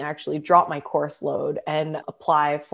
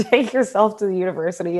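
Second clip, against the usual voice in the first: laughing at herself. Retold or imitated. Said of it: "I burst out laughing".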